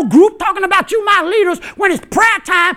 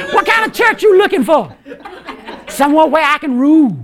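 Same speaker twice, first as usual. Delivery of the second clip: ordinary talk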